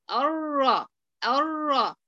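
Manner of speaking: "الرا" said with the ra only, the noon gone completely; no trace of noon. Shown as a demonstration. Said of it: "جبتي أي شكل للنون؟". ("الرا" بلا أي أثر للنون)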